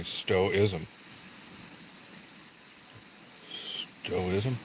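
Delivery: "Stoicism" repeated softly as STOH-iz-um; practised pronunciation. Real, not acted. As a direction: quietly try out "Stoicism" without the k, to yourself. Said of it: "How do you pronounce that".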